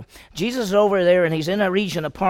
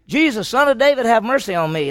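raised, high beggar's plea; shouting it out; 6 semitones higher, 5 dB louder than usual. Retold or imitated. Blind beggar calling to passing Jesus, loud plea. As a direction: call out loudly for mercy. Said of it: "he kept shouting it out".

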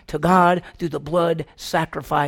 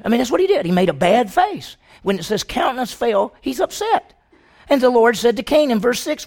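loud, raised preaching at full force; fervent preaching; 5 semitones higher, 7 dB louder than usual. Acted. Preacher pressing Cain's story on the church, loud and fervent. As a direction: drive the point home to the congregation.

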